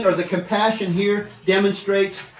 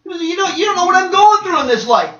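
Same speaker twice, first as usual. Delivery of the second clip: whiny complainer; 11 semitones higher, 6 dB louder than usual. Retold or imitated. Voicing a self-pitying complainer.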